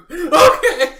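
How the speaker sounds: sudden loud, high laughing "Okay!"; retold surprised yelp; startled yell. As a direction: shock at the dragon, yelped. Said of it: "and I'm like".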